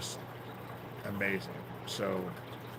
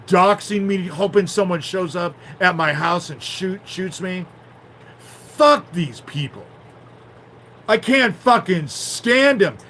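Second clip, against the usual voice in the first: real shouted rant, much higher and louder; yelling in real fury, slow and raw; furious outburst; 8 semitones higher, 11 dB louder than usual. Real, not acted. Venting at her harassers to viewers, furious shouting. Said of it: "sorry for the little outburst".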